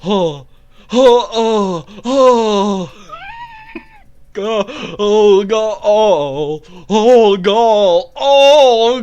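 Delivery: loud, high drawn-out moaning; mock climax moans. Comedian's series of climax impressions, mock orgasm moaning. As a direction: build loud moans around a gasped exclamation.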